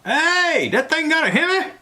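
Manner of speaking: high, loud imitation of a commercial yell. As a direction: yell it out like from a car window. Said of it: "he'd lean out the window and yell out".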